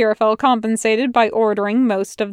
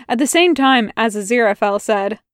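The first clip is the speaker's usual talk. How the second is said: ordinary delivery, nothing marked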